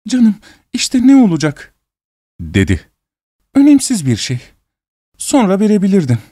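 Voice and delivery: high, tearful sobbing voice. A narrator's reading. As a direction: speak high through sobs. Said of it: "hıçkırarak".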